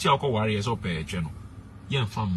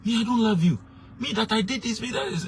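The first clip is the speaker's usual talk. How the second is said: much higher, outraged eh me; husband stunned by his wife's accusation, high disbelief; shocked disbelief; 12 semitones higher than usual. Retold or imitated.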